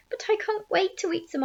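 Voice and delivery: high, pleading protest; impatient cry. A narrator's reading. Hungry aunt begging her sister, high impatient cry. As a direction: protest eagerly, pitched high. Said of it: "Aunt Sponge cried".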